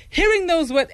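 ordinary delivery

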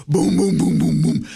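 loud booming knock sounds; mock door banging, booms fading; knocking imitation. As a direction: pound out the knocks with your voice.

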